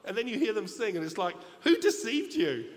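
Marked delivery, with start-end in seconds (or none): 1.6-2.7 slow, loud and much higher, laughing through the put-down; hammed-up scorn for a tone-deaf singer; mocking disbelief. Preacher mocks a bad X Factor singer, laughing scorn.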